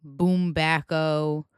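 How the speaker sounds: Boombacco slowly as boom-BACK-o; mangled surname. Retold or imitated. Podcaster quotes people's mistakes, slow boom-BACK-o. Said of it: "People have said".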